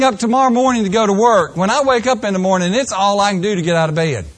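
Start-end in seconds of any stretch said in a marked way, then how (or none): none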